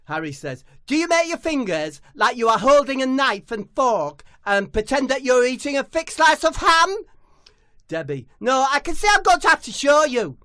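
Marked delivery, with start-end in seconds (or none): 0.9-7.0 high, loud telling-off; high loud mock scolding; high exasperated scolding. Harry guessing. 8.4-10.4 high, loud proud boast; high loud boastful footballer. Acted.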